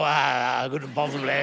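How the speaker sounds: put-on low, soft old man's mumble; hammed-up elderly murmur, dropped right down; old bloke from the Fast Show. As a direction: mumble it like a doddery old comedy character. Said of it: "looks like that old bloke from the Fast Show".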